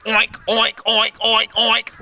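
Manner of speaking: imitated piggy oinks; five quick pretend oinks; pig oinking. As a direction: oink like a pig in short bursts. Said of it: "He goes".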